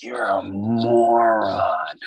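drawn-out, slow self-mockery; slow mock self-insult. Inner voice mocking himself slowly. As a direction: stretch it out, calling yourself a moron. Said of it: "I'm like".

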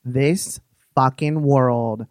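host advising a caller, low and firm; breaking it down. Real, not acted. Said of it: "I'm gonna break it down for you".

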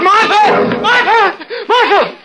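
frantic high yells of her name; panicked shouting. Acted. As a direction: scream for her, half insane with panic. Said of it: "I shouted".

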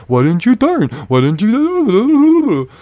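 high, loud mocking wail; mocking questioners. Old folks question driver's motives, in mocking wail. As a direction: mimic them wailing, dragging out the last word. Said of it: "stand there and question me".

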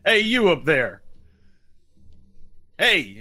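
settled call from down below; yelling up. Acted. Sheriff yelling up at the players, hollering. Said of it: "he's yelling up at you".

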